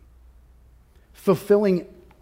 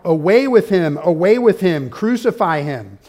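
crowd crying out; 2 semitones higher, 8 dB louder than usual. Retold or imitated. Preacher voicing the crowd demanding crucifixion, loud shout. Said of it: "they cried out".

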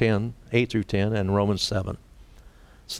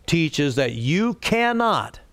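rising, forceful pulpit emphasis with a higher pitch; emphatic preaching; 6 semitones higher than usual. Acted. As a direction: drive the point home from the pulpit. Preacher stresses salvation by works.

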